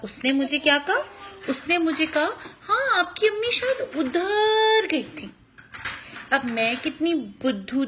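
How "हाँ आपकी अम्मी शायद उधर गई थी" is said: put-on small boy voice.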